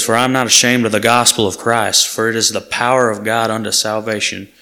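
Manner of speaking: full-voiced pulpit reading of the verse; scripture reading. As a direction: proclaim the verse to the room.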